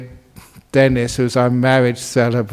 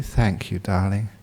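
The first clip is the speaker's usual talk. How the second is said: soft, lower, tender thanks; sweet; 5 semitones lower, 9 dB quieter than usual. Acted. Wife thanking the husband who gave in, sweetly. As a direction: say it gently and lovingly. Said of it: "smiled sweetly".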